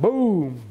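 drawn-out "boom" for a rifle shot; imitated gunshot. Camper fires rifle in the air, imitated boom. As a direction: make the shot noise with your voice.

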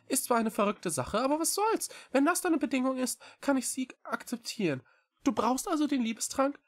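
high, light voice of a small talking cat; eager little animal agreeing quickly; small creature voice. Acted. Echo's voice in dialogue.

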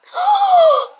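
high, loud sharp gasp; shocked gasp. Acted. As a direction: gasp in horror. Sister discovers ruined makeup.

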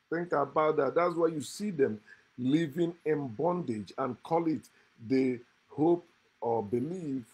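ordinary talk

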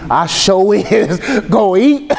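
drawn-out, amused repeat of the punchline; slow savoring repeat. Shown as a demonstration. Preacher repeating his own joke to the congregation, slow and amused. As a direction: savor each word of it. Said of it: "repeat of the line".

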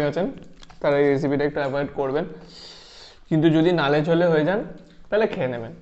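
talking between bites; eating while speaking; mouth full, chewing. Real, not acted. Seen 0: Man bites food at 0.6–1.0, keeps chewing and holding more.

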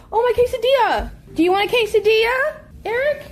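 high, loud sudden alarm; startled gasp. Real, not acted. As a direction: gasp and jump up in pitch. Gasp at burning quesadilla.

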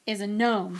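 grimly emphatic, loud first word. A narrator's reading. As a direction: announce it with grim weight. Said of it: "he said grimly".

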